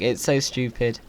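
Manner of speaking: usual speaking voice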